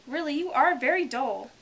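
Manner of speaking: high, loud scolding; angry. Acted. The Mock Turtle rebuking Alice, angry. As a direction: scold her with real force. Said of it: "said the Mock Turtle angrily".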